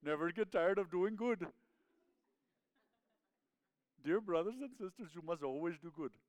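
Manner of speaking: put-on Indian accent, lilting into the verse. Preacher playfully reading a verse to church, Indian accent. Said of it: "My accent just has kinda transitioned".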